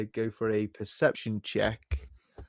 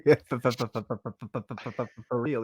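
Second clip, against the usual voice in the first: mock stutter; much faster than usual. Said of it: "selective stutter".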